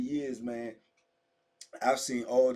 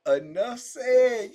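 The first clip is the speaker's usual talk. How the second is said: Enough said said slowly through a laugh, louder; 8 semitones higher, 10 dB louder, much slower than usual. Real, not acted. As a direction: laugh it out, drawn out.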